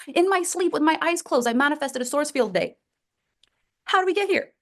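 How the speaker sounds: incredulous complaint. Acted. Acts kicked parent's disbelief.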